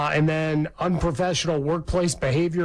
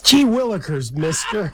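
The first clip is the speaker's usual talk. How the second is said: louder, bright gee-whiz delivery; wholesome 1950s kid; 3 semitones higher, 5 dB louder than usual. Retold or imitated. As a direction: play an eager old-timey kid. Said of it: "golly and gee and gee willikers".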